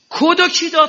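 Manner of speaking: sudden high, loud question to the crowd; shouted pulpit challenge; rhetorical shout. Acted. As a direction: fire the question out at the congregation.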